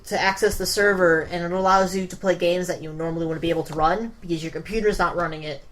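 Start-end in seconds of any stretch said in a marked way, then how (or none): none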